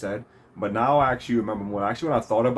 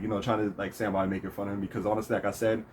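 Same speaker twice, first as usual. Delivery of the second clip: usual speaking voice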